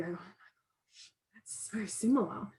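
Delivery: slow, hushed words on a sharp intake of breath; breathless gasp. Retold or imitated. Telling volunteers how she reacts to a familiar story, hushed and slow. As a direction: let it slip out soft and slow, half-gasped. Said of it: "I take a sharp breath and I go".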